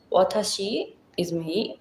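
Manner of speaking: ordinary delivery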